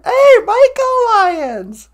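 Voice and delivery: high, loud, drawn-out greeting; calling out. Real, not acted. Greeting the cartoonist, calling out high, loud and slow. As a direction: holler it slowly across the room.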